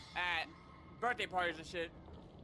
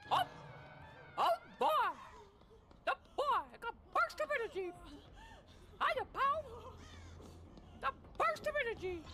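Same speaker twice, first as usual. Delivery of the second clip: high squeaky cartoon mouse voice; Mickey Mouse. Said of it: "I used to could do Mickey Mouse".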